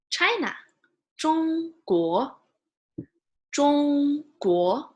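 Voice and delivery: Zhongguo built from Zhong and guo, Zhong high and level, guo rising; teacher modelling China in Chinese; Mandarin Zhongguo. Shown as a demonstration. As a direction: sound it out syllable by syllable, then join. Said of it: "This one we haven't learned yet".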